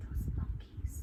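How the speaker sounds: gasp, then a whispered discovery; shocked gasp. Acted. Peddler spotting his caps on the monkeys, gasp and whisper. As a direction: breathe it out in hushed shock.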